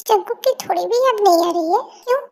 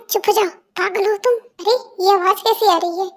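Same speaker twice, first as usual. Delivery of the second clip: normal talking voice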